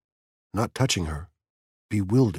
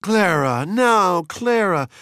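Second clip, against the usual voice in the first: high, loud pleading; begging; 11 semitones higher, 6 dB louder, slower than usual. A narrator's reading. Mildred begs her friend to calm down, pleading. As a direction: plead with a drawn-out urgency. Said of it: "begged Mildred".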